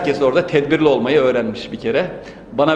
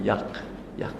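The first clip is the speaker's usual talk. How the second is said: breathy whispered answer, far softer; hushed refrain; 7 semitones lower, 15 dB quieter than usual. Acted. Poet whispers the beloved's answer, hushed. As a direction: let the refrain fall to a whisper.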